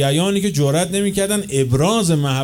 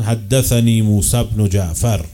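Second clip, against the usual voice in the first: solemn recitation; 4 semitones lower, 4 dB louder, slower than usual. Retold or imitated. Quoting Imam Reza's chain of narrators.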